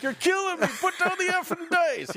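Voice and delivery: hammed-up New Yorker yelling at the dice table; belligerent New Yorker. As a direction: bark it like an angry gambler from New York. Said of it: "belligerent New Yorkers".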